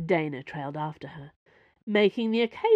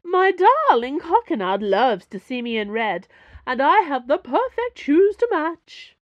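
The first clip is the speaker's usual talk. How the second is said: shrieking soap diva; 9 semitones higher, 9 dB louder than usual. Acted. Porthos fooling the salesman, shrieking like a soap diva. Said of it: "playing up an accent that tilted between new aristocrat and hollow soap diva".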